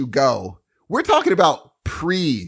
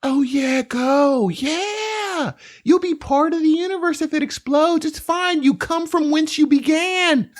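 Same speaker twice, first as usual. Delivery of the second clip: much higher, dreamy, carefree mock of the wife; floaty spiritual wife; 12 semitones higher than usual. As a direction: float every word like nothing matters. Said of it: "she's gonna let you, like".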